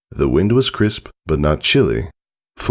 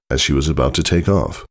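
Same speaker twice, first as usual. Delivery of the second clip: ordinary delivery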